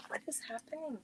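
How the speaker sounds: breathy whispered aside; hushed confused whisper. Real, not acted. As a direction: whisper it to yourself, puzzled. Confused aside amid interruptions.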